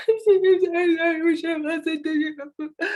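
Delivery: high, loud, slow wail through tears; hammed-up sobbing, words drowned in crying; whiny, sniffling cry-talk; blubbering. A narrator's reading. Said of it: "the blubbering, sniffling sisters".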